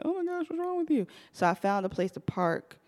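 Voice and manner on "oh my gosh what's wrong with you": much higher mock-concerned voice; concerned passerby. Passerby stopping for a crying driver, mock concern. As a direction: imitate a stranger fussing in a high pitch. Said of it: "people are going to stop and be like".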